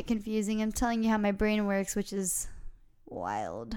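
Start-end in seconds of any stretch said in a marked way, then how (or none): 3.1-3.8 low growly big-cat voice; jaguar growl. Real, not acted. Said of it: "I sound like a real jaguar".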